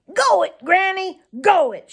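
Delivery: loud, high cheering yell; shouting. Acted. Farmer Brown's boy shouts after the fleeing fox, laughing loudly. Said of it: "shouted Farmer Brown's boy".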